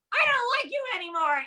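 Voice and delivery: put-on pouty kid voice, sped up; childish pout. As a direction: sulk like a hurt little kid. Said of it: "I'm just like".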